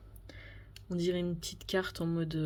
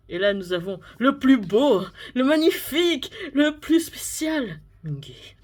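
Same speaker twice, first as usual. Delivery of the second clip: loud, slow grand build-up; hyping up; 4 semitones higher, 7 dB louder, slower than usual. Real, not acted. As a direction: announce him like a star. Hyping favourite member.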